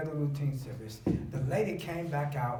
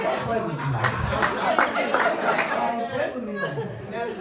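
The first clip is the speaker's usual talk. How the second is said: laughing, drawn-out then quick protest; 7 semitones higher, 7 dB louder, slower than usual. Retold or imitated. Acted protest in retold scene.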